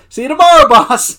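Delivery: high, very loud call-out; hearty mock shout. Creed calling out to departing Michael, loud imitated holler. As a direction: holler a cheery farewell.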